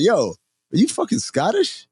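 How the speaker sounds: high, drawn-out eager question; excitedly ask, replaying the bar chat; retold excited curiosity. Storyteller to a new acquaintance at the bar, excited.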